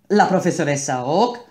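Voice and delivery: a surprised half-shout; shouted question. A narrator's reading. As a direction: blurt it out in disbelief.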